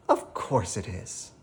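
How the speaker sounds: put-on old woman's moved exclamation; exclaiming. A narrator's reading. Missus Goldman hugging Sophia, a lightly voiced moved old woman. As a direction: say it like a touched grandmother. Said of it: "exclaims Missus Goldman".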